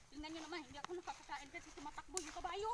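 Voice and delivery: ordinary talk, nothing marked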